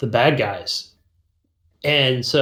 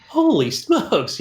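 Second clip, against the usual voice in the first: drawn-out, higher-pitched astonishment; mock amazement; 9 semitones higher, much slower than usual. Player reacting to a gruesome game story, stunned. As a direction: stretch it out, high, as if stunned.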